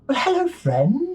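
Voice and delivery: elegant silver dragon. Acted. Silver dragon greeting its bonded player, high and graceful. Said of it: "and it goes".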